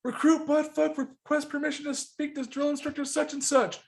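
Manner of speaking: high-pitched formal recruit call; recruit hailing drill instructor. Acted. Renamed recruit pounding on the hatch, calling out. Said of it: "he pounds on the hatch again".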